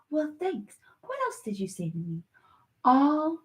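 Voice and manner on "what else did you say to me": fast, much lower and much softer; rattled off. Real, not acted. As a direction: toss it off quickly under the breath. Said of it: "repeated line, rattled off".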